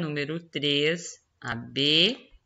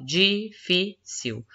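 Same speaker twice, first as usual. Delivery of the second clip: difícil broken slowly into di, fi, cil; syllable by syllable; 4 semitones higher, much slower than usual. Shown as a demonstration. Teacher splitting a word for pupils, difícil said syllable by syllable. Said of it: "Separei em sílaba".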